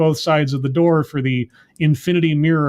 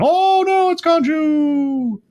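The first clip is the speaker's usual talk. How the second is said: very high, drawn-out wail of dread; hammed-up falsetto panic; mock terror; 11 semitones higher, much slower than usual. Recapping Khonshu's return, hammed-up terrified wail.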